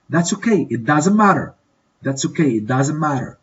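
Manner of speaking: usual speaking voice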